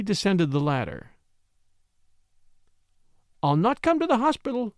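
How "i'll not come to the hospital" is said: higher warning cry; mild warning call. Acted. Woman warning him from above, mild warning. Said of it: "she cried warningly".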